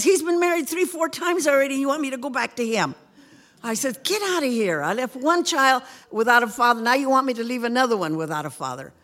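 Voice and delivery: indignant retort. Retold or imitated. Retold angry refusal.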